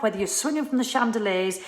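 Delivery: ordinary talk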